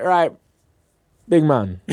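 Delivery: lightly put-on Jamaican accent, amused; imitated Jamaican accent. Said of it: "I'll stop doing the accent".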